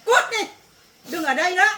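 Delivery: mother ordering her daughter out of the house; angry dismissal. Acted. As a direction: throw her out.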